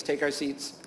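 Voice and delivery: quiet echo repeating "take our seats"; echoing tic. Retold or imitated. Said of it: "repeat just the last three words".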